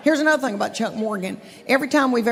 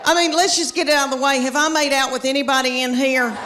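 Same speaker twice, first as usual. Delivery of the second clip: usual speaking voice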